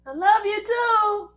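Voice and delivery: high, slow cute dog voice; voicing the dog. Speaking for Kobe back to Demetra in a high slow dog voice.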